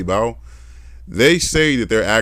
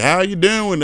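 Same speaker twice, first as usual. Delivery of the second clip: higher-pitched imitation Southern accent; 10 semitones higher, slower than usual. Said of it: "they all have Southern accents".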